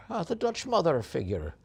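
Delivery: playful put-on Irish lilt; a hint of Irish brogue; Irish accent. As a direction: slip into a light Irish accent. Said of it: "I'm speaking with an Irish accent".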